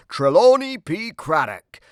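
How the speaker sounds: ordinary delivery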